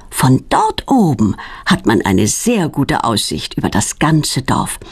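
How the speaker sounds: ordinary delivery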